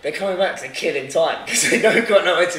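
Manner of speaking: worked-up mock revelation; animated realisation. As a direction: retell it as a loud, rising penny-drop moment. Retold sudden realisation about them.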